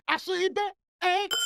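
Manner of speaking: high sing-out announcer call; show announcer call. Acted. Host doing take two of the segment intro, high announcer call.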